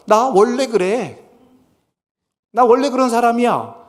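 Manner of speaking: mock stubborn "that's just how I am"; stubborn defiant quote. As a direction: dig in your heels and refuse to change. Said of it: "이렇게 말하는 거예요".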